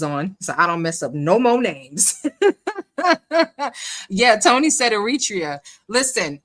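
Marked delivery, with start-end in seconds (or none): 2.3-4.0 high rolling laugh; amused laugh. Real, not acted. Host laughing about messing up names, high laugh. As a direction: laugh freely at your own blunder. Laughing at her name mistakes.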